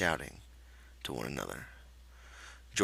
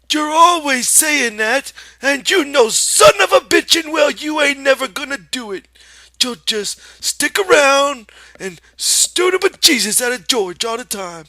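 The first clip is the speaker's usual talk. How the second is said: angry scolding aunt; 14 dB louder than usual. Acted. Aunt Clara berates Lenny, loud angry scolding. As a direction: tear into him.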